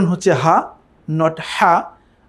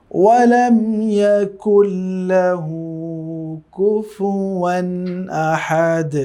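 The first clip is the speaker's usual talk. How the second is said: slow melodic tilawat; Quran recitation; much slower than usual. Shown as a demonstration. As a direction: chant it slowly, drawn out like in prayer. Recites the verse.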